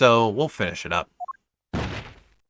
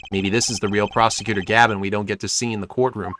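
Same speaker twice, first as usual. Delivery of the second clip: ordinary talk, nothing marked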